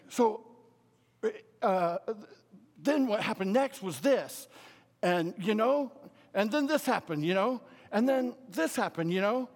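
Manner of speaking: animated, can't-wait-to-tell-you delivery; excitable storyteller. Acted. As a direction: play an excitable guy gushing what happened next. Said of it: "he's real excitable".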